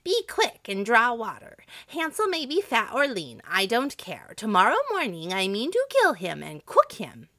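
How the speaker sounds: loud, high, impatient orders; storybook witch who will wait no longer. A narrator's reading. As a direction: snap out the orders with rising impatience. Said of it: "she became impatient".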